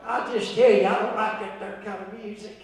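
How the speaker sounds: mock elderly fussing, trailing down soft; eighty-five-year-old grumbler. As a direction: grumble quietly like a disapproving elder. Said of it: "act like they're eighty-five".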